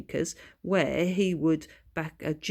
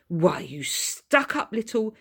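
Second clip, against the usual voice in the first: angry snap; 6 semitones higher, 5 dB louder than usual. Acted. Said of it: "snapped Frank angrily".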